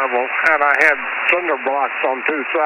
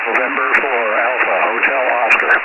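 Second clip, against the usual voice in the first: amateur radio; 5 semitones lower than usual. Real, not acted. Station checking in over radio.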